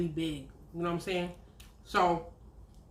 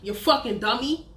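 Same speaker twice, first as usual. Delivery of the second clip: rising, louder exasperated rant; mocking rant in her head, higher and louder; inner mock rant; 8 semitones higher, 4 dB louder than usual. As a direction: let the scorn build as the rant climbs. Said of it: "but in my head, I'm like".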